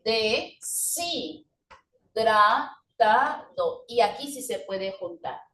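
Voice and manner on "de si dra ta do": deshidratado slowly as de, si, dra, ta, do; syllable by syllable. Shown as a demonstration. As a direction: separate every syllable of deshidratado. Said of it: "aquí sí puedo decir".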